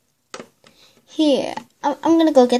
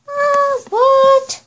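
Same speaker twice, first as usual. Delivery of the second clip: loud, very high pretend shock; 13 semitones higher, 8 dB louder than usual. Toy friend hears they are moving in tomorrow, shocked. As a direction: squeal it in disbelief. Character hears of sudden move.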